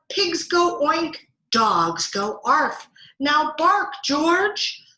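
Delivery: high, loud, insistent story mother; frustrated mother. A narrator's reading. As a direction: press each word in exasperation. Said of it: "Mother is getting frustrated".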